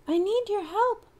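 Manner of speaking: very high little bee voice; bumbling bee. Acted. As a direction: buzz it out small. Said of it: "the bee bumbled".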